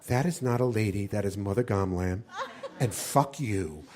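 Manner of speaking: a meek little nun with a sudden curse; prim nun. Retold or imitated. Nun defending Mother Gamlam in a low, quiet voice. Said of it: "One of the nuns ... said".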